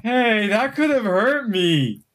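high, slow indignant ogre; offended ogre. Acted. Ogre scolding the barbarian, high slow indignant. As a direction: protest high and drawn out. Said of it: "He goes like".

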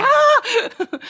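loud, high mock scream; hammed-up scream of a parent losing it; exasperated scream. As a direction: burst into a frustrated mock shriek. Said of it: "you can't help yourself".

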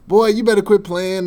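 loud, high mock scolding; hammed-up coworker telling him to cut it out. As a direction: coworker on the phone scolding a supposed prank caller, loud and high. Coworker scolds a supposed prankster.

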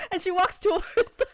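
high voice breaking up with laughter; real fit of giggles; helpless laughter. Real, not acted. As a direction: struggle to get the words out, laughing. Cannot finish story for laughing.

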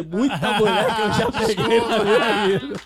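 slow, loud, half-laughing confession; drunk confession. Retold or imitated. Friend confessing to the narrator, slow laughing drunk voice. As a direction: drag the words out like a tipsy buddy. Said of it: "Juro, ele falou".

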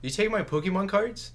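high, hammed-up outrage over nothing; mock accusation. Parodying the bad acted line.

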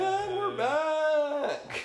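very high, loud, stretched host call; mock radio host bellow; announcer return. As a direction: sing it out even bigger, over the top. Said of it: "echoing the announcement".

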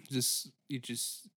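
usual speaking voice

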